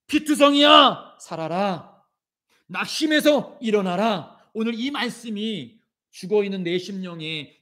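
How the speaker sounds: impassioned sermon, rising and falling; preaching. Acted. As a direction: preach it with fervor to the congregation. (열정적인 설교)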